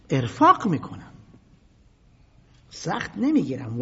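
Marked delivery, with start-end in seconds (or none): none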